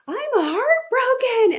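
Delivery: loud, high, drawn-out anguished cry; retold yell. Mother yelling at her friend as the hurt breaks through, yelled. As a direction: yell it out slowly, reliving the outburst. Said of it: "I yelled at her and I said".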